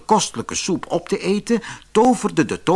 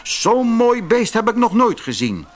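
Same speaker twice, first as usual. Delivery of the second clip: admiring villager; 8 semitones higher than usual. A narrator's reading.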